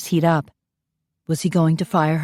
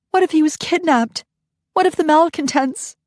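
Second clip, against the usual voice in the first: much higher, rising fear; fearful; 11 semitones higher than usual. Acted. Mother asking her husband, fearful. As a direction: spiral into worry.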